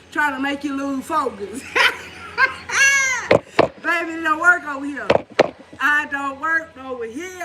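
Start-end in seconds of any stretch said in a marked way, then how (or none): 1.7-3.8 a squealing high burst of laughter. Real, not acted. Mocking hocus pocus, squealing high laugh.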